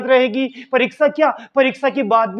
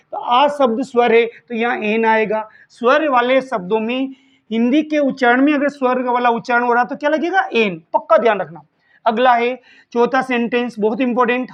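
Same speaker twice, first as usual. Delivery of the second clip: normal talking voice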